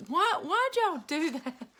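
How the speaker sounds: high, loud startled protest; startled teen. Retold or imitated. Son catching family laughing, loud startled protest. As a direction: yelp it like you've just been caught off guard.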